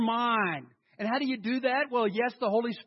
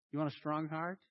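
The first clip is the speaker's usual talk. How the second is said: normal talking voice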